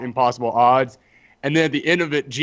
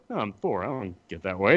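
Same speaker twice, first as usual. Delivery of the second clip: low, dismissive Thor; 7 semitones lower, 6 dB quieter than usual. Retold or imitated.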